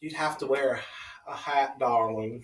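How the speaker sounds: hammed-up Southern drawl; bad Southern accent. Teasing a friend about ordering a mint julep, bad Southern accent. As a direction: put on a poor Southern accent. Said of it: "That's a horrible Southern accent".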